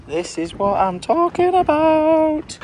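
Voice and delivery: high, slow, rising exclamation; relishing delight. Real, not acted. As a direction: draw it out with mounting delight. Relishing the place and food.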